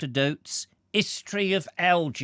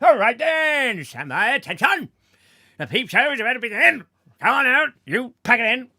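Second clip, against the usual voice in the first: high, loud, fast barked commands; drill sergeant clown; 10 semitones higher, 8 dB louder, faster than usual. Acted. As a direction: bark orders at the crowd like a drill sergeant.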